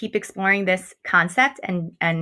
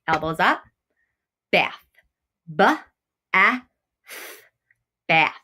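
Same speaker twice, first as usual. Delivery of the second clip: bath, then B A T H, each letter tapped apart; tapping out sounds; 2 semitones lower than usual. Shown as a demonstration. Teacher leading students in tapping out bath. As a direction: tap out each sound deliberately. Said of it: "Let's tap it".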